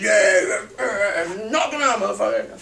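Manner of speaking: imitating a knuckle-dragging bro; dumb tough guy. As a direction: ham up a brainless brawler. Mocking a dumb aggressive guy.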